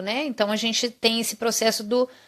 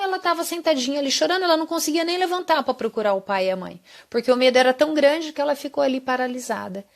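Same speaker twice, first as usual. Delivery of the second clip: quick, rushed telling; fast, tumbling explanation; rushed retelling; 4 semitones higher, faster than usual. Real, not acted. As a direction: hurry through the story. Retelling child's paralysis.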